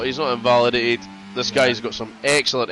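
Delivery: usual speaking voice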